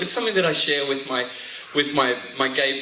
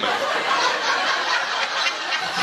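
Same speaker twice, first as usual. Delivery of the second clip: big laugh. Real, not acted. Audience laugh.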